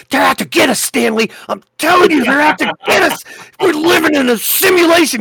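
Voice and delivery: hammed-up, high, loud terrified mouse; mock panicked mouse. Paranoid mouse warns Stanley they're out to get them, mock panic. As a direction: play a paranoid mouse yelling a frantic warning. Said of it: "mice that are just in the corner, just shaking".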